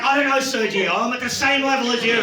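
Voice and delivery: high-pitched, exasperated comeback; mock-indignant, pitched well up; exasperated protest. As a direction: protest loudly to a young co-worker. Acted protest to a backpacker.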